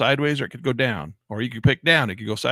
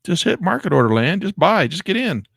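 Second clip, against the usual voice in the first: higher and louder, pushing himself to act; urgent; 8 semitones higher, 6 dB louder than usual. Real, not acted. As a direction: urge yourself to jump in now. Urging himself to buy.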